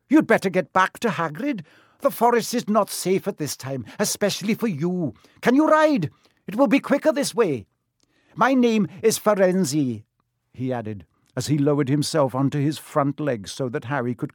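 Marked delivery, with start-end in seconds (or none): 0.1-10.0 high-pitched centaur voice, earnest; urgent centaur rescuer. Acted. Centaur offers the rescued boy a ride, high and urgent. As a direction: advise the boy in a raised centaur voice. Centaur figure advising Harry.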